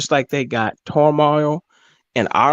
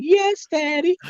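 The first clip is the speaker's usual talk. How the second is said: high mock female voice; 13 semitones higher, slower than usual. Imitating Ukrainian women.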